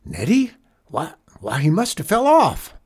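dawning horror, voice climbing; alarmed. Acted. Driver realizes boy is lost.

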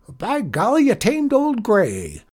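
high, delighted cowboy praise; excited cry. A narrator's reading. Janitor praising the boy who tamed the chair, excited. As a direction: crow over the rider's victory. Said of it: "he cried".

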